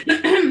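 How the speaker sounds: sharp throat clear after tea. Real, not acted. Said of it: "I'm drinking a new tea".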